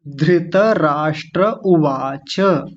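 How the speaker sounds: धृतराष्ट्र उवाच said slowly, every syllable drawn out; careful recitation. Shown as a demonstration. Teacher reading the heading slowly to students. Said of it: "क्या लिखा हुआ है?".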